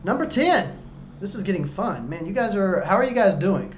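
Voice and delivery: usual speaking voice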